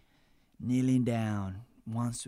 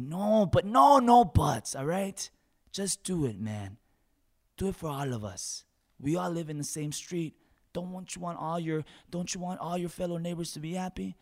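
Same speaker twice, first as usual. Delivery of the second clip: urging loudly; 6 semitones higher, 6 dB louder than usual. Retold or imitated. Loud pleading with Harry.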